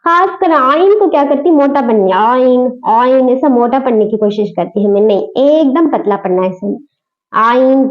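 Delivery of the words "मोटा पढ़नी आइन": ordinary talk, nothing marked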